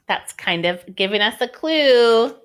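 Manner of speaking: usual speaking voice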